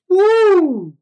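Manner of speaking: high loud woo; excited whoop. Real, not acted. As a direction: let out a wild whoop. Excited whoop after strange bird.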